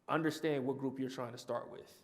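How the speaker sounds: normal talking voice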